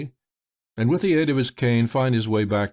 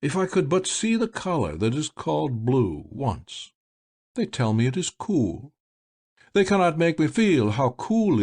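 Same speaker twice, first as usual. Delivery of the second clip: higher, longing voice wishing to see blue; wistful blind magician; 4 semitones higher than usual. A narrator's reading. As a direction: ache gently for a color never seen. Malvolio, wistful, rising at end.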